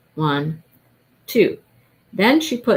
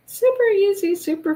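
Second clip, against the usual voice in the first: gushing; 12 semitones higher than usual. Real, not acted. Gushing over finished hat.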